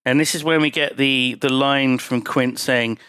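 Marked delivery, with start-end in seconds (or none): none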